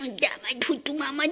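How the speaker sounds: high doting whisper. Real, not acted. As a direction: breathe it lovingly. Whispering to pet.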